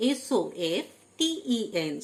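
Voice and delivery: ordinary talk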